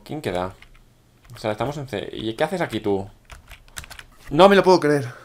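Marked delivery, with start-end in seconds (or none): 4.3-5.1 loud, high disbelieving cry; shocked shout. Real, not acted. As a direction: yell it out in real disbelief. Shocked at losing the game.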